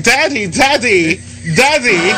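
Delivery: put-on high-pitched, drawn-out kid voice; imitated children. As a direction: mimic kids calling for their father. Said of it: "and the kids was like".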